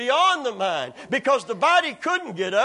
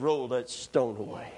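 calm command; 10 semitones lower, 7 dB quieter than usual. Retold or imitated. Jesus telling the men to open the tomb, calm command. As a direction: give the order quietly. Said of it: "He said".

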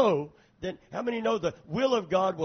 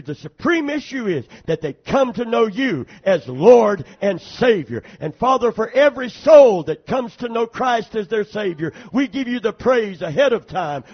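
praying aloud, building louder; fervent prayer; 7 dB louder than usual. Acted. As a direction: lead the congregation in prayer. Pastor leads closing prayer.

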